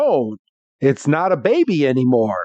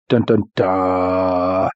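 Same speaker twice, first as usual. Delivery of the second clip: mock suspense sting; 8 semitones lower, much slower than usual. Dramatic suspense jingle.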